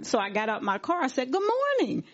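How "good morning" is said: bright, high good morning; cheerful greeting. Retold or imitated. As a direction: greet strangers with big friendliness.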